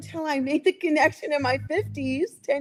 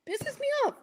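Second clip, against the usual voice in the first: real anger; 12 semitones higher, 4 dB quieter than usual. Real, not acted. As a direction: voice jumps high in indignation. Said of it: "I'm obviously very incensed".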